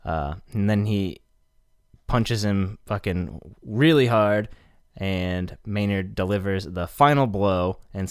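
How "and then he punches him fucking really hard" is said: ordinary delivery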